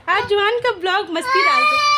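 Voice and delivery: lifted and loud, talking over the cries. Real, not acted.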